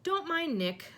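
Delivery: high, hearty call; mild boisterous shout. A narrator's reading. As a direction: call it out jovially to the room. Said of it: "shouted Sir Patrick's head".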